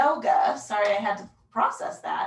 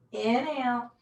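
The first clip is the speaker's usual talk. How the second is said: ordinary delivery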